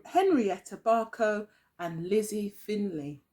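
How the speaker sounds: ordinary delivery, nothing marked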